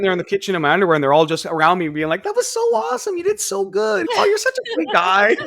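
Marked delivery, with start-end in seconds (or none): 2.2-5.3 very high falsetto imitation; gushing girls. Girls around him after the show, mock gushing falsetto. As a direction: gush in a mock girly squeal.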